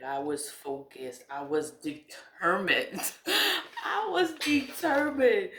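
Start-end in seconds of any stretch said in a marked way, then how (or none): 3.2-5.5 high-pitched, laughing exclamation; laughing emphasis. Real, not acted. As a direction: burst out higher through laughter. Repeats it, breaking into laughter.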